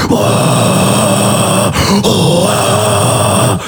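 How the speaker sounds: low sustained crowd noise; long held crowd sound; crowd roar imitation. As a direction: fake a whole audience cheering. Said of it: "je fais aussi mon propre public".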